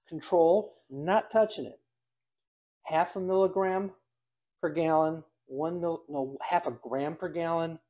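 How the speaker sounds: usual speaking voice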